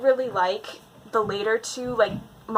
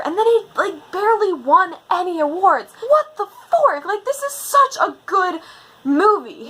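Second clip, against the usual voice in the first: indignant outburst rising much higher and louder; exasperated rant about the snub; worked-up fan ranting about award snubs; getting angry; 9 semitones higher, 5 dB louder than usual. Real, not acted. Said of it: "I'm getting angry".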